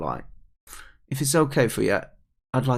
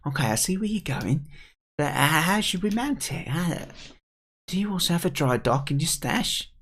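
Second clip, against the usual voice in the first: high, put-on kid voice; doubtful young character; 6 semitones higher than usual. Kid asking the pike about mounting, doubtful. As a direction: question the plan, pitched up.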